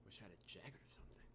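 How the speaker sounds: fast shivering whisper. Acted. Heroine on the icy floor whispering to herself. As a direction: whisper it against the cold.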